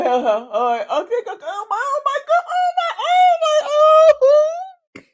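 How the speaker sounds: half laugh, half sob, overwhelmed; real emotional laughter breaking high; laughing through tears. Real, not acted. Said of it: "I think I'm getting emotional".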